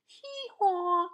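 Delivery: high, loud hee-haw; loud storybook donkey bray. Acted. Donkey's bray in read-aloud story.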